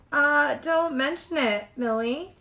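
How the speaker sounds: talking around an egg; words through a mouthful; mouth full. A narrator's reading. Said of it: "She had an egg in her mouth".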